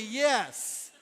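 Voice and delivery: loud, high, eager yes; excited agreement. Pretend. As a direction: cheer it like it's finally your song. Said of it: "Then we would all say".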